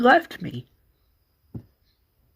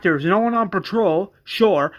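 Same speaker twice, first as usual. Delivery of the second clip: ordinary talk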